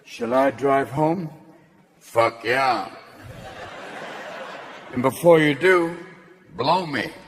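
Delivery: deep sleazy car voice. Retold or imitated. The car answering its drunk owner, low and sleazy. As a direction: drop the voice low and suggestive. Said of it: "You get in the car a little loaded going".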